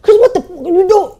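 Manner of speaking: "what the fuck" yelled high and loud at Chris; an angry yell at Chris; harsh shout. Shown as a demonstration. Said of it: "I'm like".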